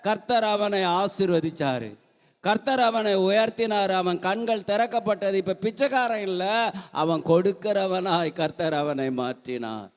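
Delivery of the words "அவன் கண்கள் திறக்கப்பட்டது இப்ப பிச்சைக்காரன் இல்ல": normal talking voice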